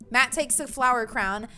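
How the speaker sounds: normal talking voice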